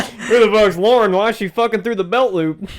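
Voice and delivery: loud and high through laughter; laughing outburst; laughing yell. Acted. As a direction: crack up while yelling it. Echoes with laughter.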